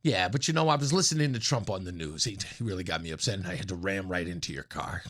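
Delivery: very low, muffled dopey driver excuse; mock excuse voice. Driver who rear-ended you, lame low excuse. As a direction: play a dim driver blaming the radio, low and droning. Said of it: "and I could just go".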